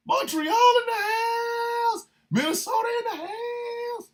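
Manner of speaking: very high, drawn-out holler; hype shout-out. Real, not acted. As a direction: stretch the last word like a hype man. Shout-outs to listeners' cities.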